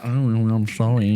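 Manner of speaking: slow, put-on sorry voice; mock apology. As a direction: drag out a mocking imitation of an apology. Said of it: "And you're there like".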